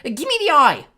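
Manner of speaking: loud, high-pitched desperate wail. A narrator's reading. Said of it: "Wasp wailed".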